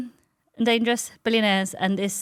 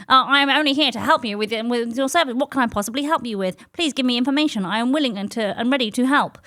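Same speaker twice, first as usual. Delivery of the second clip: mock-officious helper voice, a little raised; formal chatbot; 3 semitones higher, 3 dB louder than usual. Changed chatbot offering service, mocked formal assistant. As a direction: imitate an over-eager customer service bot. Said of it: "the personality completely changed".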